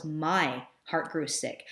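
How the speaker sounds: "My heart grew sick" read slowly and deliberately; slow, deliberate quotation; slow quote reading. Shown as a demonstration. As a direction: linger on each word of the quote. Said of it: "the original quote was".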